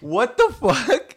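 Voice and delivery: high, loud and slow stunned yell; stunned outburst. Retold or imitated. Retelling how the fake deaf lady spoke, stunned slow yell. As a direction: drag it out in total disbelief.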